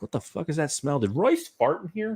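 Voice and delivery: mildly baffled, pitch climbing to the end; puzzled reaction. Acted. Wakes to smell, acted reaction.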